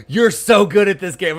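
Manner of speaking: loud, high mock-kid gushing; imitated nephew, high and loud, amused; excited nephew. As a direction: gush like an admiring nephew. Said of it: "my nephew's like".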